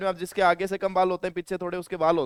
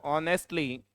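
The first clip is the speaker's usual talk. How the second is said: slow dictation; 10 semitones lower, 4 dB quieter, much slower than usual. Shown as a demonstration. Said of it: "ये सही लिखा है क्या मैंने".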